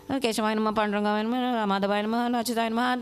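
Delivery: imitating a hurried rote puja chant; perfunctory recitation. Showing children a careless puja, perfunctory recitation. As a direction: rattle off the names mechanically, barely separated.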